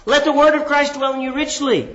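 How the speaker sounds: high-pitched, emphatic opening on "Let the word of Christ"; emphasis on beginning. Shown as a demonstration. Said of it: "put the emphasis on the beginning".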